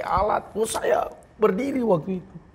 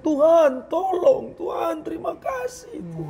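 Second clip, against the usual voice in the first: crying out to God; 10 semitones higher than usual. Retold or imitated. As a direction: call out to God, pleading then thankful. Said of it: "hanya teriak".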